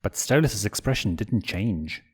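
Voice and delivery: plain reading voice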